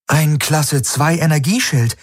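mildly surprised. A narrator's reading. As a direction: report a finding, slightly taken aback. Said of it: "Sie schien überrascht".

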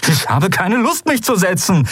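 high, agitated refusal; angry defiance. Acted. Kai refuses the admiral's order to sit, defiant. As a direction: refuse outright, voice strained upward.

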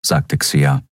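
ordinary delivery, nothing marked

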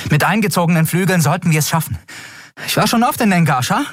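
a pilot focused mid-chase, talking fast; determined young pilot. Acted. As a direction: Endriel explaining her escape route. Endriel reassures crew.

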